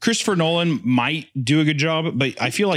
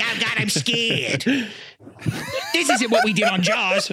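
mock a timid director, pitched up; scared Spielberg; 9 semitones higher than usual. Mocking Spielberg as a timid director, high and scared. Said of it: "He's like".

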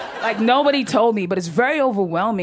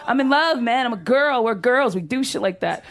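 ordinary talk

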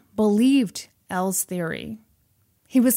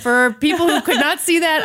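laughing explanation; 7 semitones higher, 5 dB louder than usual. Real, not acted. Laughing at own wild gesture.

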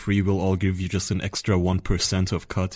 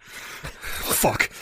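whispered curse; 5 dB quieter than usual. Acted. Caught villain whisper.

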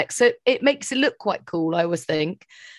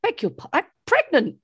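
higher indignant protest; pushing back, offended, higher; 7 semitones higher than usual. Retold or imitated. Pregnant woman protesting to the guard, higher and indignant. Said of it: "I said".

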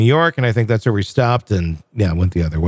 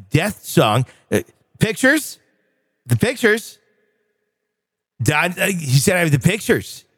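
higher, exasperated radio-host tirade; Casey Kasem rant; 5 semitones higher than usual. Retold or imitated. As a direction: demand the pictures like an irate DJ. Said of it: "the Casey Kasem outtake reel".

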